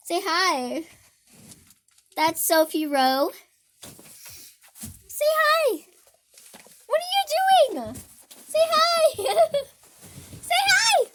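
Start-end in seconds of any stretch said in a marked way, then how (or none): 5.1-11.1 pet voice. Real, not acted. Asking her pets to greet viewers, high cooing voice. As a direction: coax the pet to say hi.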